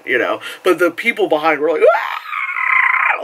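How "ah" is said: very high scream; fan scream. Retold or imitated. People behind losing it, a very high scream. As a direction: lose it screaming. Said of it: "they were losing it".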